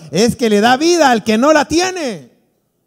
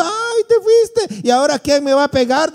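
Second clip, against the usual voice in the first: slow, high mock wail, much higher; mock wailing widow; 7 semitones higher than usual. Preacher imitates a widow crying over her husband, mock high slow wail. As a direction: stretch it out like a wailing widow at the wake. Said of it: "llorando y llorando y gritando".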